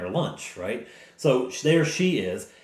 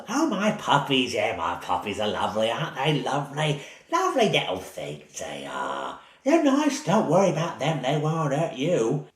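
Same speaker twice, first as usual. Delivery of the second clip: put-on sweet old woman voice. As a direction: croon it like a harmless granny hiding something.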